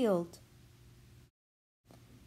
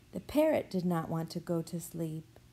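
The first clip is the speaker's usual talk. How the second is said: ordinary delivery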